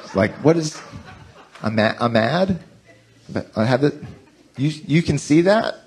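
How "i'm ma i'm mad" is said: normal talking voice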